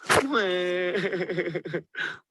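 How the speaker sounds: high, wavering mock bawl; long stuttering storybook wail; baby's wail. A narrator's reading. Baby Sweetcorn's stick has snapped, mock wailing. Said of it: "wailed Baby Sweetcorn".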